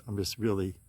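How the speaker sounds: usual speaking voice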